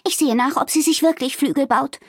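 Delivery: high, keen little voice; announcing eagerly. A narrator's reading. Fiona off to check on Amalie, eager. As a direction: announce it, ready to dash off. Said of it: "verkündete Fiona und flitzte los".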